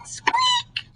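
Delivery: tiny high mouse squeak. Acted. The mystery noise in Owl's house, high squeak.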